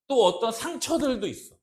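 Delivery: ordinary delivery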